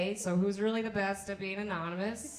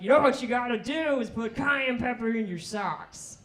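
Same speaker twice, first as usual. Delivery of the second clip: a slightly higher, louder know-it-all voice; guy's advice; 4 semitones higher, 5 dB louder than usual. Retold or imitated. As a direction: hand out the tip like a sure thing. Guy's advice, acted quote.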